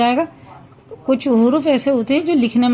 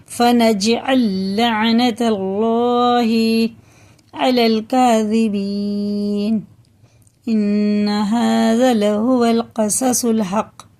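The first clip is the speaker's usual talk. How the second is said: slow melodic chanted recitation; Quran recitation; much slower than usual. Acted. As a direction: chant the verse slowly with long vowels.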